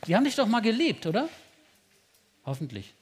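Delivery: normal talking voice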